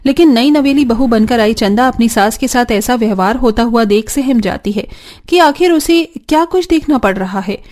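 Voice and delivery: ordinary delivery, nothing marked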